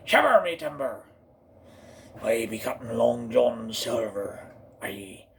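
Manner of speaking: hammed-up pirate, dropping to a low aye. Introducing Long John Silver, hammed-up pirate. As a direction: roll it out like an old-time pirate. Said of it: "ain't done no pirates".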